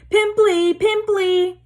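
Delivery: high, loud mocking yell; yelling taunt. A narrator's reading. Peeves taunting Myrtle as she flees, high loud jeer. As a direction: jeer the name as he flies after her.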